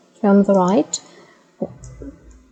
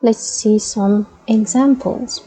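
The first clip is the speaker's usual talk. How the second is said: ordinary talk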